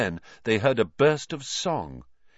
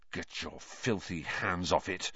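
low, hushed anger; soft, lower-pitched muttered snarl; snarl under breath; 4 semitones lower, 10 dB quieter than usual. A narrator's reading. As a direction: seethe it quietly. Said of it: "Harry snarled under his breath".

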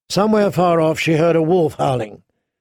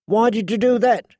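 screamed question; 7 semitones higher than usual. A narrator's reading. Arya protesting after being knocked off the wagon, contained scream. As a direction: protest in a raised, higher voice. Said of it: "she screamed".

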